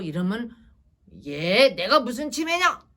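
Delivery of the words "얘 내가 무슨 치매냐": loud, indignant retort; acted angry outburst from an old father; angry elderly parent. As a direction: snap back at the suggestion, offended. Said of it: "이러고 화를 내시잖아요".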